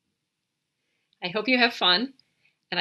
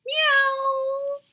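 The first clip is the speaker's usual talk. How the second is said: very high, loud meow; high cat calls; 5 dB louder than usual. Shown as a demonstration. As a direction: mew like a talking cat. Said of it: "Can you say meow in cat talk?".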